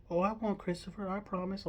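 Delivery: high, earnest storybook bear voice; Winnie the Pooh. Retold or imitated. Pooh promising Christopher Robin to remember him, imitated high bear voice. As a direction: imitate a bear making a promise, pitched well up. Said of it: "And Pooh, promise not to forget me".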